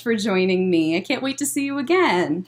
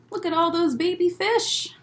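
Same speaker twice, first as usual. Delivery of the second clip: plain reading voice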